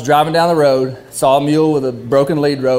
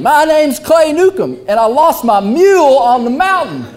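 yelling it again; 9 semitones higher than usual. Retold or imitated. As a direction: shout it so the bartender hears. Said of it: "so I yell it again".

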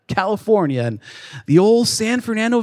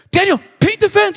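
mock shout; 9 semitones higher than usual. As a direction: bark it like a sparring call. Retold sparring match, shouted.

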